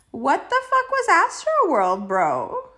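loud high what the fuck; exasperated outburst. Real, not acted. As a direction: burst out in disbelief. Outraged topic opener.

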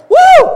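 very high, loud "woo"; exultant high-pitched holler; celebratory whoop. Acted. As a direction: cheer with a piercing whoop. Whoops as God cheering.

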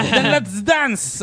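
hammed-up high slow call to dance; mock friend, hammed-up. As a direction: drag out the invite like a party host. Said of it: "he goes".

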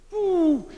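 high excited woo; joyful whoop. Real, not acted. Preacher overflowing with joy.

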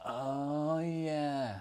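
long drawn-out, high, soft "oh yeah"; mock realisation. YouTuber pretending petrol pumps work in power cuts, sarcastic drawl. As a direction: stretch it out with sarcasm. Sarcastic answer to his own question.

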